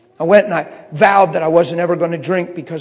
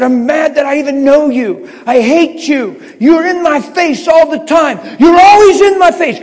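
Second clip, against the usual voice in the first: furious high yell, far louder than talk; angry screaming; 11 semitones higher, 11 dB louder than usual. Retold or imitated. Young man screaming at his Bible, enraged. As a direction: scream the accusation, building in pitch and force. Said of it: "I take the Bible and I scream at it".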